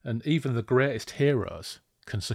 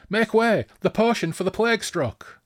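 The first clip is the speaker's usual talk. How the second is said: high, loud urgent cry; yelled command; 12 semitones higher, 4 dB louder than usual. Acted. Rider bluffing through the camp with a potion, yelling. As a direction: yell it over the crowd. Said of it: "He yelled again".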